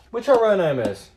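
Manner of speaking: calling out to the cat. Acted. Shouting question to the cat.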